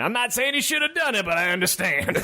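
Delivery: high, excited stand-up comic voice; Chris Rock impression. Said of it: "Chris Rock just popped into my head saying".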